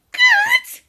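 very high, outraged screech; old woman's scream. A narrator's reading. Grandma outraged by George's cheery remark, screaming. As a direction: scream the word in disbelief. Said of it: "she screamed".

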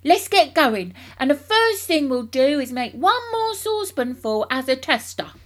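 loud, high rallying call; eager father taking charge noisily; excited cry. A narrator's reading. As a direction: urge him on, raring to go. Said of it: "cried Mister Cranky, leaping up".

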